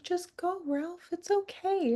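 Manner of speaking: high, soft sad plea; Vanellope's tearful goodbye. Retold or imitated. Fan quotes Vanellope telling Ralph to go, soft and high. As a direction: voice the girl letting him go gently. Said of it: "She's like".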